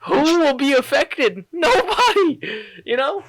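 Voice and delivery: shrill mock-indignant defense with a laugh; mock outrage. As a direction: protest your innocence in disbelief. Retold indignant reply, laughing.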